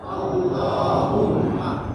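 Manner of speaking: almost whispered, drawn-out Allahumma; quiet repeat of the prayer word; soft echo. Shown as a demonstration. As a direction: breathe the word softly as a repeat.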